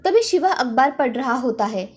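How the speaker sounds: plain reading voice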